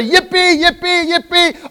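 mock cheering. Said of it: "so we say".

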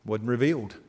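usual speaking voice

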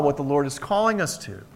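ordinary delivery

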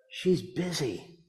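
put-on very soft, small child's voice; little boy. A four-year-old answers the pastor, very soft.

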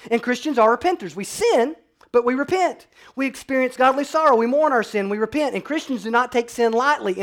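loud, high, fervent sermon voice; preaching at full force. Acted. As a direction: lift it up and drive it home to the congregation.

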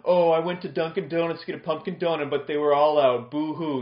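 mock a friend's disappointment; mocking taunt. Friend mocks the speaker over missing pumpkin donuts, sing-song taunt. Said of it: "you mock me by saying".

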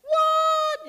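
very high, loud mock-shocked yelp; shocked response. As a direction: squeal it in exaggerated disbelief. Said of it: "that's how our response is".

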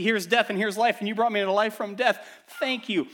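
joyful rescued-soul outburst; grateful exclamation. Acted. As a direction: cry out thanks to the rescuer. Preacher voices grateful rescued one.

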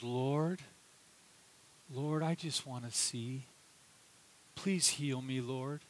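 soft, low pleading; humble plea. Retold or imitated. Blind beggar pleading with Jesus to see, soft plea. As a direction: quietly beg to see.

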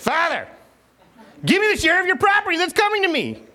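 silly, high-pitched put-on voice for a son; comic squeaky-high demand to a father; weird voice. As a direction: pipe it up high in a goofy character voice. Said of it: "I'll say it in a weird voice".

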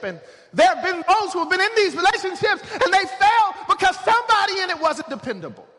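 high, urgent pulpit cry; full-force preaching. Real, not acted.

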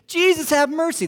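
a high, loud cry for mercy; pleading lepers. Acted. Lepers crying out to Jesus from afar, pleading high. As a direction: call out desperately from a distance.

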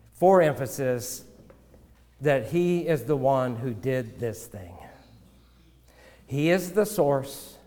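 ordinary talk, nothing marked

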